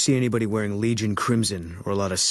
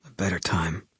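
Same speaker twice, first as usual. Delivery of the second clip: whisper. Acted. Sniper remembering his dead wife to the courier, whisper. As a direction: let the memory fade into a breath.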